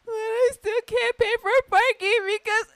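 high, tearful imitation, on the verge of sobs; retold crying. Telling mom she still can't pay, imitated tearful whine. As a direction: whimper it out, still overwhelmed. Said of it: "I was going through it".

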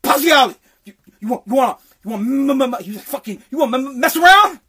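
loud mock stammer, bursting through the blocks; stuttering caddy master. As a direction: imitate an angry boss stuck on his M's, then blurting it out. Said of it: "he stuttered, he had a lisp".

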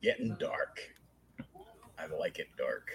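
very soft, half-whispered relish; hushed aside. Pretend. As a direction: drop to a low near-whisper. Hushed aside on dark turn.

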